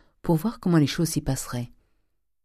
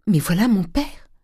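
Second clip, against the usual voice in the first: higher, urgent exclamation; alarmed warning; 6 semitones higher, 5 dB louder than usual. A narrator's reading. Eugénie warning that her father is coming, mildly alarmed. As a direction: warn them with a touch of alarm.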